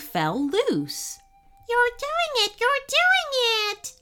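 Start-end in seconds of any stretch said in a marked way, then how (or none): 1.7-3.9 very high, delighted squeal; gleeful chipmunk. A narrator's reading. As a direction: cheer on the rescuer in a tiny voice. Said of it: "laughed the chipmunk".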